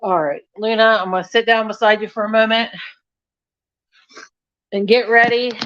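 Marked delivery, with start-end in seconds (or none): none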